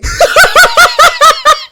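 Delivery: howling laugh; loud burst of laughter. Real, not acted.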